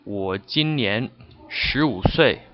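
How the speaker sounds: 我今年十五岁 said slowly, word by word; slow careful demo of 我今年十五岁; slow model sentence. Shown as a demonstration. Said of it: "you would say".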